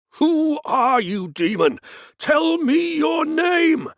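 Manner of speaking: high, slow, loud command; exorcist's shout. Acted. Priest shouting demand for the demon's name, slow roar.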